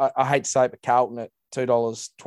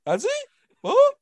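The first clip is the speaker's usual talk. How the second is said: high, confused, stammering mock reporter; flustered boundary rider. Imitating his co-host as a lost boundary rider, high and flustered. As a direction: play him lost and caught off guard. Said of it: "And you're like".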